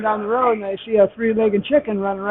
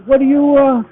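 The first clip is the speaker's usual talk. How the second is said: higher, louder joke-character question; acted puzzled question; 4 semitones higher, 7 dB louder than usual. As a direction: press the question to set up a punchline. Puzzled question setting up the punchline.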